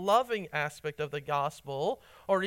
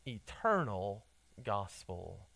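lower, soft and slow, savoring eternal gospel; savoring the phrase; 8 semitones lower, 5 dB quieter, slower than usual. Shown as a demonstration. Preacher savoring a phrase he loves, softly. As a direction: linger on eternal gospel, low and tender. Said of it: "I love that phrase".

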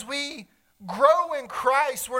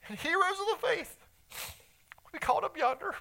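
emotional, softer, voice catching; choked up; 5 semitones higher, 5 dB quieter than usual. Real, not acted. Pastor moved by the thought of reunion, softer and choked. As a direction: let the feeling catch in your throat. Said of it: "I just get emotional when I see this".

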